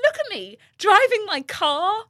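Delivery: high, drawn-out, emotional self-talk; hammed-up overwhelmed marvelling; retold tearful pride. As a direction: wail it proudly, half in jest. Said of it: "like, crying".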